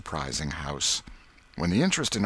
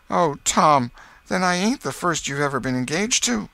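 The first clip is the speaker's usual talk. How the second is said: put-on high, louder dismayed girl; hurt girl Becky; 10 semitones higher, 6 dB louder than usual. Becky learns of rival, upset.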